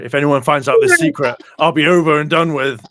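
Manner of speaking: hammed-up fear of being found out; mock panicked director. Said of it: "he's just like".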